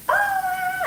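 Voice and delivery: high-pitched imitated scream; retold yell. Owner as pit bull shakes her dog, high retold yell. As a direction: re-enact a panicked high "Ah!". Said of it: "yelling like crazy".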